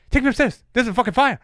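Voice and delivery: high, urgent attempt at a Scottish accent; rough stab at a Scottish accent; bad Scottish accent. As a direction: imitate an alarmed Scot, accent badly done. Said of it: "how to do a Scottish accent".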